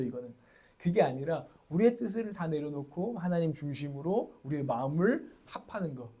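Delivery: ordinary talk, nothing marked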